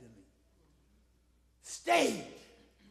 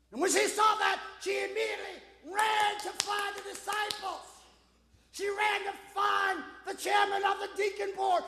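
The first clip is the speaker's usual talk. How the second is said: high, urgent pulpit storytelling; ringing preacher's delivery; impassioned preaching; 9 semitones higher, 7 dB louder than usual. Real, not acted. Preaches at full force.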